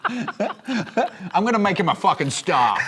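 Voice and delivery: slow, high mock English accent; hammed-up English accent with a laugh in it. Comedian voices his friend's dad bragging, mock English accent. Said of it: "I'm only doing this accent".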